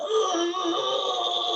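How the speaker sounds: long, high-pitched wailing cry; imitated howl. Acting out his father grabbing him, high anguished howl. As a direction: wail out high, acting someone else's anguish. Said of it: "y gritaba".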